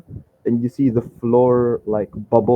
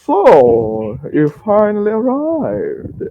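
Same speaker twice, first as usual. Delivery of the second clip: high, drawn-out grand welcome; theatrical merchant; 8 semitones higher, 4 dB louder, much slower than usual. Acted. Merchant spots his awaited guest, slow theatrical welcome. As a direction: draw it out slowly, swooping down from high.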